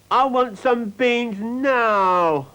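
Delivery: high, loud, slow drawn-out whine; whining spoilt girl. A narrator's reading. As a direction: whine it like a spoilt child who wants it now. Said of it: "whined Fifi".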